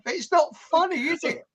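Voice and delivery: high-pitched, offended mock Rhodesian; Rhodesian accent. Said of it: "about his accent".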